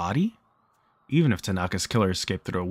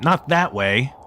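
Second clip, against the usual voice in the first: shouted warning, a bit higher and louder; mild shout; 4 semitones higher, 5 dB louder, slower than usual. Acted. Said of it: "Mister Kobayashi shouted".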